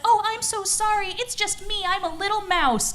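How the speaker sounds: high-pitched little mouse voice; apologetic mouse. Acted. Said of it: "And the mouse said".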